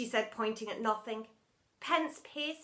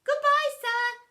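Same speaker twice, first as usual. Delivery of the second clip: high, loud singsong farewell; calling out; 11 semitones higher, 8 dB louder than usual. A narrator's reading. Pupil leaving teacher on the ground, calling out. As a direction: call it across the playground. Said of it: "called out Rebel".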